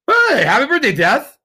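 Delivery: put-on high, loud, cheery birthday greeting to a baby; mock parent greeting. Mock parent cheerfully greeting their newborn named death, high and loud. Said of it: "I can't imagine their parents called them death".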